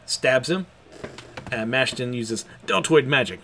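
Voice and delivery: normal talking voice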